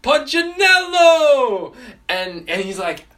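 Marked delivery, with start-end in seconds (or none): 0.0-1.7 huge, drawn-out booming call; thunderous shout from an unseen giant. Retold or imitated. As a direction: bellow the name slowly, high and loud. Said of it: "he hears this booming voice".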